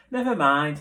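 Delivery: wolf cheering up, a mild happy lift. A narrator's reading. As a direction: bounce back with a cheerful lift. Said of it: "he said happily".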